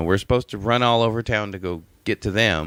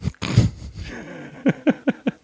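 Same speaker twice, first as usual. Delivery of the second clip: high laugh. Real, not acted. Laughs at the joke.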